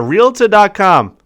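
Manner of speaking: a playful hint of Boston on Realtor.com; little Boston accent. Host naming the next source in a playful Boston accent. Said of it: "I gave it with the little Boston accent there".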